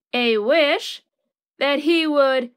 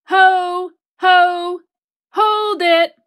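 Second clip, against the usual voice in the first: higher, louder halting call; christmas elf; 4 semitones higher, 5 dB louder, slower than usual. A narrator's reading. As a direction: cry out to stop someone, higher. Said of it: "cried the elf".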